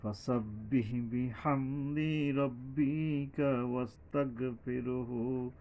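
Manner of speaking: very slow, drawn-out chanted recitation; tajweed chant; Quran recitation. Shown as a demonstration. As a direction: chant each word, holding the long vowels.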